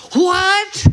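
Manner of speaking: high, loud, outraged What; emphatic What. Shown as a demonstration. Paul rejecting the Corinthians' conduct, a high loud emphatic What. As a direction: fling it out as a shocked objection. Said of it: "He said it this way".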